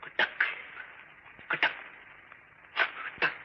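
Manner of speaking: soft clipped knocking footsteps, kuttak; footstep sound effect. Acted. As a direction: mimic slow creeping footsteps. Imitates footsteps in story. (காலடி ஓசை பாவனை)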